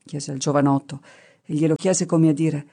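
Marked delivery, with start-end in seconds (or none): none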